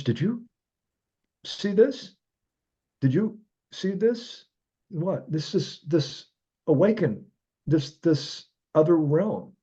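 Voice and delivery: high, slowing wonder; awestruck. Retold or imitated. Awed question to friends.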